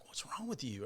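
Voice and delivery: soft, offhand mimicked query; quietly quoted question. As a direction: quote the partner under your voice. Retells partner's question.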